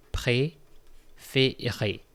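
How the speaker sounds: "préférer" spoken very slowly, each syllable clear; slow, careful préférer. Shown as a demonstration. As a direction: stretch out "préférer" so students hear every vowel. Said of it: "from the verb préférer".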